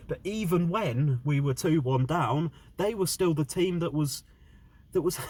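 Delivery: angry rant. Real, not acted. Fan ranting about team's loss.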